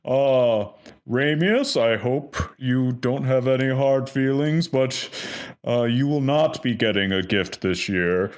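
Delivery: high jolly Santa with a cool spy edge. Acted. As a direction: boom a ho ho ho, then hand out judgment kindly. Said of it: "and he goes".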